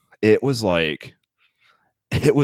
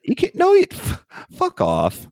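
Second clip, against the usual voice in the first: exasperated outburst; 4 dB louder than usual. Real, not acted. Mock exasperation.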